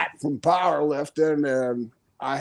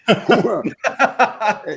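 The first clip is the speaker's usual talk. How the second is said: a loud, higher burst of laughter; real laugh; 6 semitones higher, 3 dB louder than usual. Real, not acted. Laughing at the idea of becoming a doctor, real laugh. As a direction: break up laughing at yourself.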